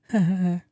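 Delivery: brief demonstrative chuckle; little chuckle. Shown as a demonstration. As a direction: let out a small put-on chuckle. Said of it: "like a little chuckle".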